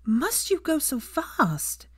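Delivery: slow, high exasperated question. A narrator's reading. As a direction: drag it out, fed up. Exasperated Hermione voiced.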